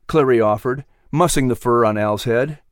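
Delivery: plain reading voice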